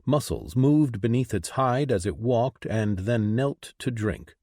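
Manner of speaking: usual speaking voice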